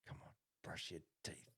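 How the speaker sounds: hushed parental warning, barely voiced; imitating his own menacing quiet whisper. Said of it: "with a quiet whisper".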